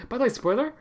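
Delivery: high-pitched, casual questioning blurt; random spoiler blurt. Acted. Streamer imitating himself spoiling a movie at random, high-pitched blurt. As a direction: toss it out high, like an oblivious spoiler. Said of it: "me just randomly being like".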